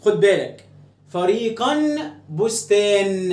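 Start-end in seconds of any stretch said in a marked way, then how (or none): 1.1-3.3 "فريقًا بستان" very slow, each ending in a held n; final n sounded. Shown as a demonstration. Teacher compares two words ending in nun, both n sounds held. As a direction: stretch "fariiqan, bustaan" to show the matching endings. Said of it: "الاتنين آخرهم نون".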